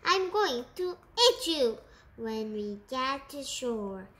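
usual speaking voice

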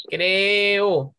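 "Creo" drawn out as cre-o; slow teaching split of "Creo"; separated vowels. Shown as a demonstration. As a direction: give E and O in "Creo" a beat each. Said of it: "Hay dos vocales abiertas".

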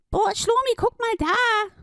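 very high excited duck voice; a little duck pointing, thrilled. Acted. Duck calls Schlumi to look, high and excited.

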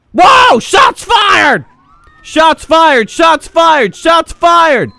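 very high, loud yell; alarmed shouting. Pretend. Cat officer reporting gunfire, play-acted alarmed shout. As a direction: scream the alarm in repeated bursts.

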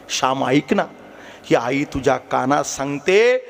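ordinary talk, nothing marked

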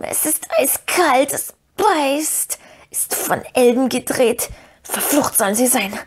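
pained creature wail, drawn out and high at first; croaking Gollum. A narrator's reading. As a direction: wail in a slow croak, then curse. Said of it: "krächzte Gollum".

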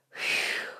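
breathy unvoiced sigh of relief; whispered whew of relief. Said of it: "said Mister Fox".

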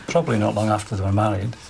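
ordinary talk

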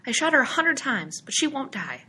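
put-on high, insistent child's complaint; yelling boy. A narrator's reading. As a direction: pitch it high like a protesting little boy. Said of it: "he yelled".

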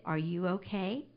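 soft, low tender question; so sweetly. Retold or imitated. Stranger asking a second time, softly and sweetly. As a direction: imitate a gentle, caring stranger. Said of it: "just so sweetly".

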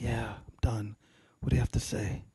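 near-whispered low reply; hushed, drained acted surrender; subdued, spent. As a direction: answer quietly, all vented out. Spent man answering God.

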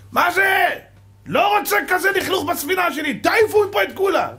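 high furious shout of a ship's captain; angry captain. Acted. Captain yelling at the stokers to throw out the coal, high furious shout. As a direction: bellow the orders like an outraged captain.

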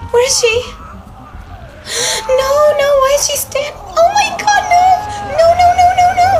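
high, loud frantic shouts of no; panicked screaming. Real, not acted. Streamer seeing Clem in danger, panicked screaming. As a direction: scream in real panic at the screen.

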